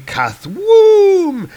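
high, loud, drawn-out boom word; explosion noise. Retold or imitated. Narrator voicing a giant explosion, booming and elongated. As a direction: stretch the comic-book sound effect out. Imitates comic-book explosion sound.

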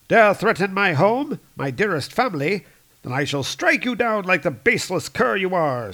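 loud, high furious warrior; angry threat. Acted. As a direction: threaten him with fury. Fandral's angry threat to Thor.

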